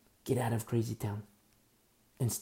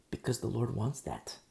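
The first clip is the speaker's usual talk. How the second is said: ordinary delivery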